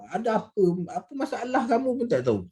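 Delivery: high-pitched mock challenge; confrontational tone. Shown as a demonstration. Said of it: "macam tu lah bunyi dia".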